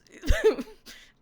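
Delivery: high loud burst of laughter; cackling at the memory. Real, not acted.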